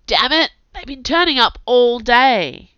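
loud, frustrated curse at the wheels; angry outburst. Acted. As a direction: snap it out, fed up and loud. Prince startled by cursed wheel.

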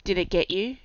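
ordinary delivery, nothing marked

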